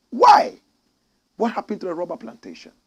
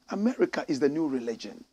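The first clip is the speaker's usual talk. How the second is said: usual speaking voice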